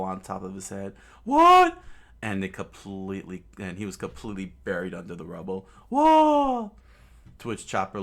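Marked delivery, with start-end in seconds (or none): 1.2-1.8 very high, very loud startled cry; shocked yelp. Acted. Rubble falls on Usopp. 5.9-6.7 very high, very loud startled cry; shocked yelp. Acted. Buried under the rubble.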